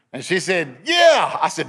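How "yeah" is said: high, loud burst of recognition; exclaimed "yeah", pitched way up; excited yeah. Retold or imitated. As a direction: blurt it out loud and high, retelling her reaction. Wife's excited confirmation retold.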